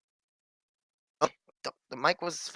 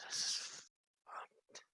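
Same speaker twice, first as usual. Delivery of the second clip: despairing whispered curse; 20 dB quieter, slower than usual. Real, not acted. Player whispering despair at the broken stream. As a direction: breathe it out, defeated.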